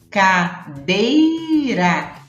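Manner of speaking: CADEIRA very slowly as ca-dei-ra; sílabas divididas. Shown as a demonstration. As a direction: stretch each syllable of CADEIRA. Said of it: "cuidado pra dividir as sílabas". (CADEIRA bem devagar, sílaba por sílaba)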